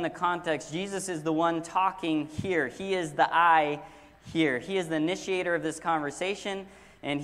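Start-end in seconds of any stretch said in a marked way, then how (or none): 3.3-3.8 "I" set apart and much louder; stress on I. Shown as a demonstration. Preacher saying Jesus is the speaker, emphatic "I". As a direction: punch the lone "I". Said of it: "We will emphasize a different word".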